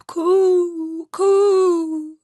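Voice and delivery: high, loud drawn-out coo; pigeon cooing. Acted. Wood pigeons call out to Gerda, high and loud. Pigeons end their tale.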